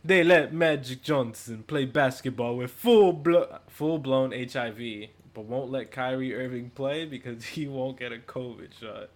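mock Italian accent, slow and loud. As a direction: read the tweet like an Italian. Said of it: "I'm gonna do it in the Italian accent".

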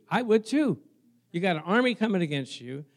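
ordinary talk

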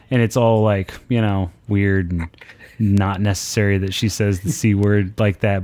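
usual speaking voice

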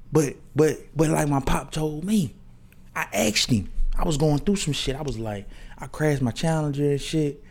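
ordinary talk, nothing marked

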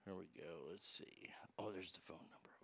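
soft unvoiced whisper to himself. Real, not acted. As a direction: whisper so the call won't hear. Quietly dialing a crank call.

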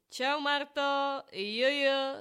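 loud, high, drawn-out fake-friendly greeting; předstíraně nadšené. Her forced cheery reply to Marta. (hlasitý, protahovaný pozdrav na oko)

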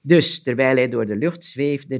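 ordinary delivery

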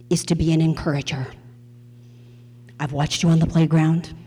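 put-on low, firm lecture to a child; stern nun. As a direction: lay down his calling like a strict nun. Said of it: "like, stern".

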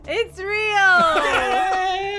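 laughing, high and loud; excited laughing shout. Real, not acted. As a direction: burst out with the reveal. Host reveals answer excitedly.

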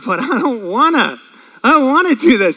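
high whiny complaining voice; whiny man child. Pretend. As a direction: whine like a sulky kid. Preacher acting whiny reluctance.

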